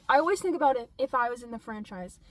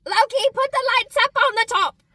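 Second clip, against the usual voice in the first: mock bossy call; 10 semitones higher, 10 dB louder than usual. Voicing Thor to Loki.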